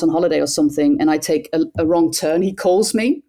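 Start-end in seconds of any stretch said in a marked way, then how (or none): none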